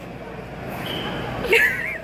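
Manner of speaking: airy, squeaky giggle of disbelief; high breathless laugh. Real, not acted. Friend gushing after the movie, high airy giggle.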